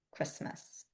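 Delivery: near-voiceless whisper; whispered demo. Shown as a demonstration. Teacher demonstrating the Christmas sign, whispered. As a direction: mouth the word barely aloud. Signing demo, word softly mouthed.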